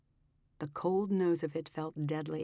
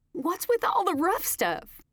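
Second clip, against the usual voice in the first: much higher, louder complaint; high annoyed bride; 14 semitones higher, 6 dB louder than usual. A narrator's reading. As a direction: complain to the driver.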